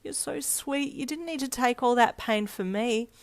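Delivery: high, tearful, softened voice; sobbing. A narrator's reading. Friend hugging the one who took the hits, sobbing. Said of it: "you sobbed".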